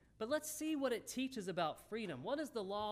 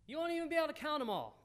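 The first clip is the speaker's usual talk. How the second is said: much higher, louder and fast, full of wonder; excited; 9 semitones higher, 5 dB louder, much faster than usual. Real, not acted. Preacher telling the congregation of countless offspring, excited and fast. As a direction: burst out with amazement.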